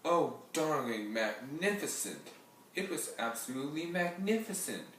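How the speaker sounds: gushing with delight, rising high; Aunt Lena gushing over the matinee show. Acted. Aunt bursting in from the matinee, gushing. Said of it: "gushed Aunt Lena".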